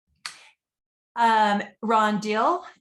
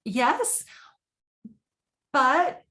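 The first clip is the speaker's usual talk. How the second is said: retold hesitant yes; 5 semitones higher, 3 dB louder than usual. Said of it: "I sort of stammered and stuttered".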